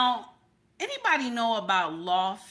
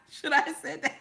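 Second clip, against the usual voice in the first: ordinary delivery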